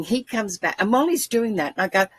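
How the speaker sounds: ordinary talk, nothing marked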